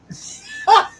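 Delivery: breathy high shriek of laughter. Real, not acted. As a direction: let out a squealing laugh. Laughs at reading's joke.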